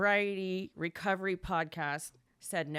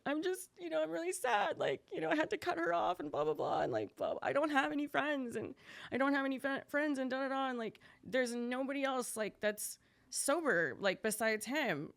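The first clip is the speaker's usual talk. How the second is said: higher, teary-sounding retelling of her own sobbing words; retold crying plea; 7 semitones higher than usual. To her husband, a mock tearful lament, high-pitched. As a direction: replay the tearful complaint, lifted high but not truly crying. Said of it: "I was just crying in my bed".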